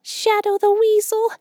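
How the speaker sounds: very high, small squeaky voice, scared; hushed frightened squirrel. A narrator's reading. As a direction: squeak it as if afraid of being overheard. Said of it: "still in a very low voice".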